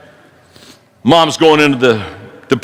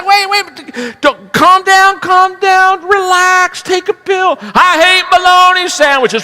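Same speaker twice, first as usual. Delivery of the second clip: shrieking coworker; 13 semitones higher, slower than usual. Acted. Coworker urging the complainer to calm down, very high. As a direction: squeal it as the other worker. Second worker responds in the joke.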